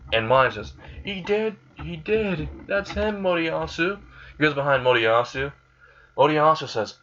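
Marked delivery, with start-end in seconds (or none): 1.0-4.0 put-on high-pitched accusing girl character. Mine tells the king who did it, high accusing voice. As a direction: pipe up high to point out the culprit.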